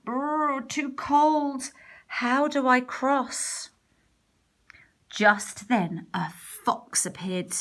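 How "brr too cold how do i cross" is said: higher, slow shivery worry; a cold little cookie at the riverbank; shivering gingerbread man. Acted. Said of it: "he stopped and shivered".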